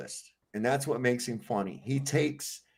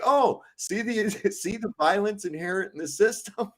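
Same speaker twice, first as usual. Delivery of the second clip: mock outrage with laughter; 7 semitones higher than usual. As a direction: cry oppression while laughing. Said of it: "he's like".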